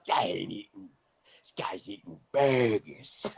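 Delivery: low, strained whisper of an Italian impression; mock Italian running out of breath; over-the-top Italian. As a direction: wheeze it out like an overdone Italian, out of air. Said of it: "over the fucking top Italian".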